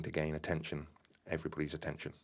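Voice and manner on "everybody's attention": plain reading voice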